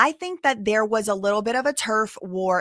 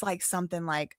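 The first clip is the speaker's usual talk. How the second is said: ordinary delivery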